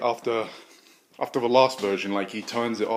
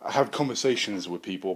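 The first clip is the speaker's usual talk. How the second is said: usual speaking voice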